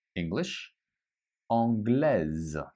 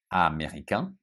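usual speaking voice

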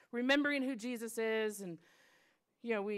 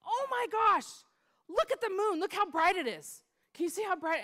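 high, loud exclamation of wonder; amazed child; 10 semitones higher, 6 dB louder than usual. Retold or imitated. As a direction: imitate a little girl bursting with excitement. Girl suddenly amazed by the moon.

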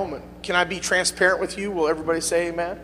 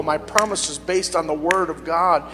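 usual speaking voice

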